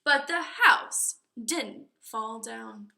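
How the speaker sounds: ordinary delivery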